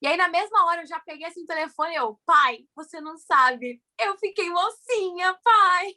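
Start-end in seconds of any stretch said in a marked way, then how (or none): 2.3-5.9 high, drawn-out happy reveal to dad; retold proud, delighted phone call; thrilled announcement. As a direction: build higher to a loud final call. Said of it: "Toda contente".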